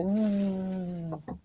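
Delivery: ordinary talk, nothing marked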